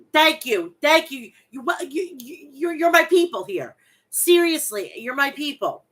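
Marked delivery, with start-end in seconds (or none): 1.5-5.8 excited. Real, not acted. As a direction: gush at fellow New Yorkers. Excited bonding with New York chat.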